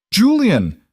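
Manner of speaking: sharp high shout of a name; urgent call. Acted. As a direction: call him back urgently. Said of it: "Marcus appeared from somewhere".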